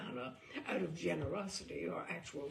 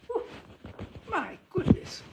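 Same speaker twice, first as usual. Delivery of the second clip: high, loud startled exclamation breaking into a laugh; startled relief; 11 semitones higher, 6 dB louder than usual. Real, not acted. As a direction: catch your breath after a fright, then laugh it off. Startled by a surprise, then relieved.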